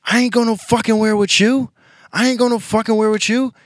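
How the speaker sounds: imitating the girlfriend screaming back at him; shrill angry girlfriend. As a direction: squeal it like the furious girlfriend. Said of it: "she was like".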